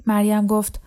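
plain reading voice

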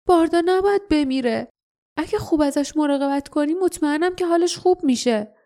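put-on high-pitched, determined boy's plea; tearfully determined. A narrator's reading. (مصمم، با صدایی زیرتر)

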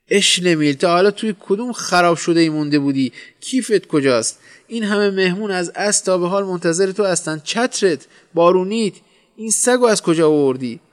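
high, loud, indignant questioning; shrieked scolding. A narrator's reading. Wife at the door to her late husband, shrieked scolding. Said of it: "به محض دیدن من جیغ کشید".

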